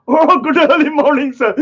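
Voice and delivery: a laughing mock courtroom hello; laughing mimicry. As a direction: giggle through the fake greeting. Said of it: "I can imagine Rebel Mode in court".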